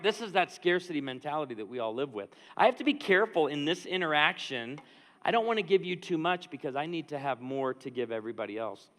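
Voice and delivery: normal talking voice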